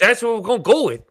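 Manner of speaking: high, quick, badly faked Irish accent; worse Irish accent. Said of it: "that's not fucking Irish either".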